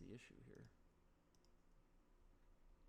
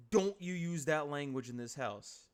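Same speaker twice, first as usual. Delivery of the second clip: stern father; 3 semitones higher, 7 dB louder than usual. Acted. Stern father scolding his son.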